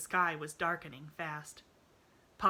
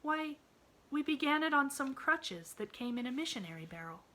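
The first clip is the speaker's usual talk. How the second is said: put-on bright, high little girl voice; eager child reassuring her friend; cheerful little girl; 9 semitones higher than usual. A narrator's reading. Pollyanna voiced in story.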